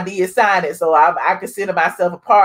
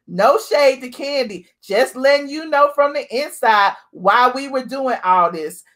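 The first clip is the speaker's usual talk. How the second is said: ordinary delivery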